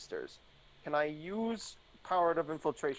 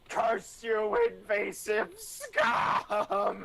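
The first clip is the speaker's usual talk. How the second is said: high, slow mock scream; screaming cartoon fish; 7 semitones higher, much slower than usual. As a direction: shriek it like the angry cartoon fish. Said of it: "the pink fish is, like, screaming".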